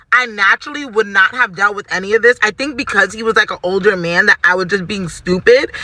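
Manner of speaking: normal talking voice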